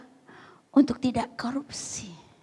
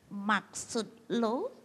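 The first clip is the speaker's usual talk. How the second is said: very slow, drawn-out question; suspicious comeback; 4 dB quieter, much slower than usual. Acted. Wife challenging her hopeful husband, slow wary question. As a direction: stretch each word in doubt.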